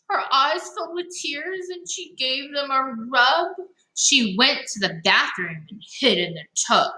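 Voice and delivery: lively storybook read-aloud; reading to children. Acted. Teacher reading a picture book to young listeners, lively. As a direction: read it to the kids with bounce.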